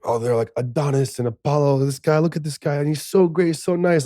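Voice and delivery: high-pitched, gushing imitation; gushing admirers. Imitating people in Greece admiring him, gushing in a high voice.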